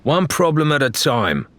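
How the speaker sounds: firmly. A narrator's reading. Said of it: "Adelaide said firmly".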